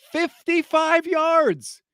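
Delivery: high, slow Fifty-five yards stressed; incredulous emphasis. Real, not acted. Stressing tiny number.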